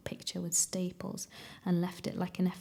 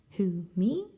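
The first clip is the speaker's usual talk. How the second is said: mock innocent; 5 dB louder than usual. A narrator's reading. Man denying he killed Martin, innocent act. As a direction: swoop up as if amazed to be accused. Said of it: "he said innocently".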